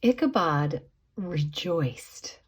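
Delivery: usual speaking voice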